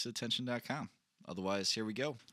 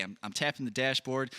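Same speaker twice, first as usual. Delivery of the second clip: ordinary delivery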